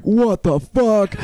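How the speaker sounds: slow, high, drawn-out mock shock; stunned disbelief. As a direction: stretch each word in retold disbelief. Retold shocked reaction to peeing.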